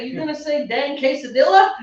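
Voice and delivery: loud, high hammed-up Texan; exaggerated Texan mangling of Spanish food words; mock Texan accent. Teasing the recorded girl's awful Spanish, mock Texan accent.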